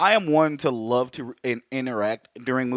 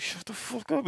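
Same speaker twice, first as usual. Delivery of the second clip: hushed scold; 9 semitones higher, 10 dB quieter than usual. Retold or imitated. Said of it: "they're just like".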